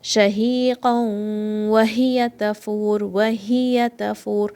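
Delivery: Shahiqan wa hiya tafur slowly with sounds merged; merged slow recitation. Shown as a demonstration. Teacher models idgham slowly for students. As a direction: glide the sounds together in idgham. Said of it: "merge these sounds together".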